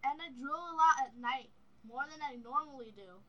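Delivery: talking through new braces; slightly lisped speech with braces. Real, not acted. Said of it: "I can't talk correctly, because his braces are".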